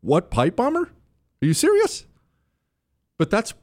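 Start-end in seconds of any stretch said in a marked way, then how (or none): 0.0-2.1 high disbelieving what pipe bomber; head cocked, can't believe it; incredulous. Retold or imitated. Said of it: "I looked at her, almost my head cocked to the side".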